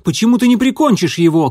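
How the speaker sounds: urgent, high, loud shouting. A narrator's reading. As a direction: demand an answer, yelling. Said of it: "крикнул он".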